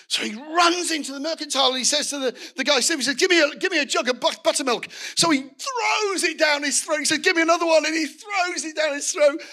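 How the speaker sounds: high, loud, urgent retelling; frantic storytelling. Retold or imitated. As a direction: act out a man desperate for a drink. Frantic rush to gulp buttermilk.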